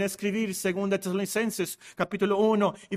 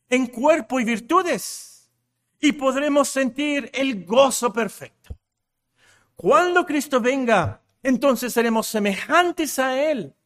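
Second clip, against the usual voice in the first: higher, measured, ringing proclamation; emphatic preaching; 6 semitones higher, 5 dB louder than usual. Real, not acted. Preacher proclaims future joy to the congregation, emphatic preaching. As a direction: preach each phrase out with weight. Preaches main point forcefully.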